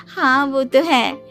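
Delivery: lightly voiced smug wife, giggling; vain daughter-in-law. Bride lapping up cousin's compliment, mildly smug. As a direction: boast with a little pleased giggle.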